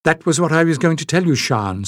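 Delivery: fast, rushed burst of words; tumbling out her confession quickly. Acted. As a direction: let it all spill out at once. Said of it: "the words at last tumbling out".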